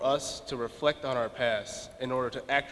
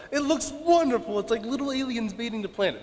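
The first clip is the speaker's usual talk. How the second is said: imitating his young self, much higher and a bit louder; childlike wonder; 8 semitones higher, 3 dB louder than usual. Third-grader seeing snow for the first time, mock childlike wonder. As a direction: replay a kid's first awe at snow. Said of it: "and I was like".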